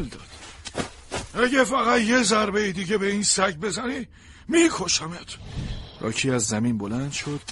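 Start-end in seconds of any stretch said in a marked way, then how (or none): 1.3-5.4 higher, louder menace; furious threat. Acted. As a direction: warn him you will kill him. Stranger threatens Rocky.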